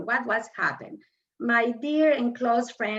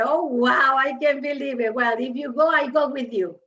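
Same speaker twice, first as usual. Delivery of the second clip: louder, thrilled oh wow; excited agreement; 2 semitones higher, 5 dB louder than usual. Retold or imitated. Telling her friend she will join him, thrilled. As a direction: burst out with delight and commit.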